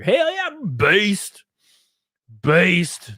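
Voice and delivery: loud, drawn-out hype shout; hyped cheer. Real, not acted. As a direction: stretch it out like a bro cheering. Hyped reaction to chat.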